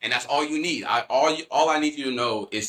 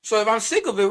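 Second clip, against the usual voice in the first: high, fed-up plea, building in pitch; retold prayer rising to exasperation; impassioned plea; 9 semitones higher than usual. Man pleading with God at the cross, impassioned and fed up.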